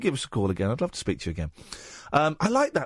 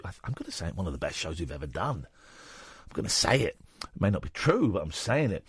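quiet aside; 12 semitones lower, 6 dB quieter than usual. Real, not acted.

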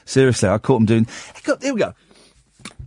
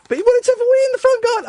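incredulous; faster than usual. Real, not acted. Incredulous retelling.

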